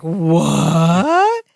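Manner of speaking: low, drawn-out rising disbelief; mock outrage. Balky learns of the raided chest, mock outrage. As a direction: stretch it out, climbing into an indignant question. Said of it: "He's mad".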